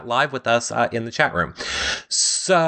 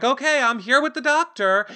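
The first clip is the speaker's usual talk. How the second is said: high chirpy host; 12 semitones higher than usual. Retold or imitated. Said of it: "Jeff's like".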